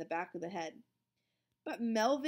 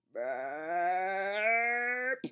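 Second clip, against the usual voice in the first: burp; 5 dB louder than usual. A narrator's reading. Big burp.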